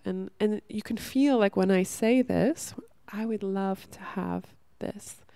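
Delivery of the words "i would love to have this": softer and slow, receptive. Shown as a demonstration. Showing women the softer vibration of wishing, softly. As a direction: let the wish land gently, no pushing. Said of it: "the vibration is already more soft".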